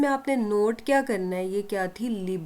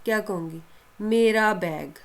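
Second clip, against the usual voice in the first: ordinary talk, nothing marked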